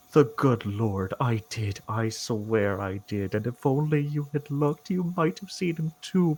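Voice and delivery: halting priest, growing fervent; Father Fitzgerald, defeated. Acted. Fitzgerald swearing he saw the Lord, halting then fervent.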